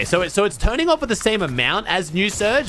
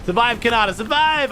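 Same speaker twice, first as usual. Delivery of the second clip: rising excited cheer for the player; 6 semitones higher than usual. Real, not acted. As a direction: urge him on, climbing higher.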